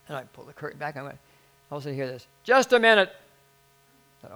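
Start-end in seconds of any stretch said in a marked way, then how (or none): 2.4-3.1 loud, high imitation of someone calling out; calling out sharply. Someone stopping the departing pastor, calling out sharply. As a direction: halt him with a raised voice. Someone suddenly calls out to him.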